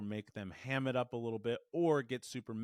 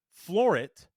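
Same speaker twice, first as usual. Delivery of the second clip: loud, higher-pitched punch; emphatic burst; 6 semitones higher, 11 dB louder than usual. Shown as a demonstration. Coach showing what staying in gear allows, loud emphatic burst. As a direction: floor it with a sudden surge of voice.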